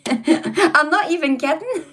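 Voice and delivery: slow, laughing through the words; real laughter breaking up the line. Real, not acted. Streamer laughing at her own car theory, slow laughing delivery.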